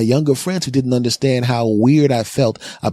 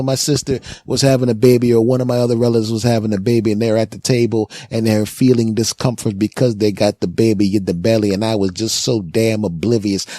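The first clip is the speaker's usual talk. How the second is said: ordinary talk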